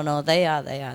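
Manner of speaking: ordinary talk, nothing marked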